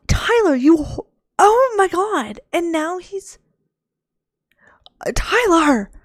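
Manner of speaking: high, loud disbelief; shocked outcry. Real, not acted. Player sees Tyler, Kelly and a burglar in her game, shocked outcry. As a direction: exclaim in high-pitched shock.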